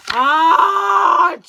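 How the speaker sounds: loud, drawn-out "achoo"; big booming mock sneeze; huge sneeze. A narrator's reading. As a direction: explode into a giant story-bear sneeze. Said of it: "He blows and he sneezes".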